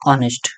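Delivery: ऑनेस्ट said as "onest", no h sound; ऑनेस्ट with a silent h, starting on the "o"; silent h demo. Shown as a demonstration. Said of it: "इसको प्रोनाउंसिएट कैसे कर रहे हैं".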